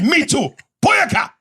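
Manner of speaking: loud, very high fake-Jamaican outburst; mock Jamaican accent. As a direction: fire back in hammed-up Jamaican. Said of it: "I started doing my Jamaican accent".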